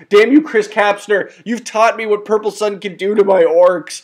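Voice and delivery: hammed-up outrage at an old rival; theatrical grudge, half laughing; mock curse. Mock cursing his opponent.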